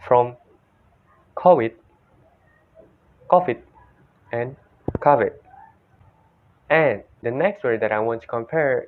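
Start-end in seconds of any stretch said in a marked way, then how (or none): 1.3-1.7 "covid" said "co-wid"; Thai pronunciation. Shown as a demonstration. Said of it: "See? From". 3.3-3.6 "covid" said "ko-fit"; higher-pitched "covid" with f for v; Indonesian-style "covid", ending on a t; Indonesian pronunciation. Shown as a demonstration. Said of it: "See? From". 5.0-5.3 "covid" said "KAH-vid"; American-style "covid"; American pronunciation. Shown as a demonstration. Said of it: "in the American accent".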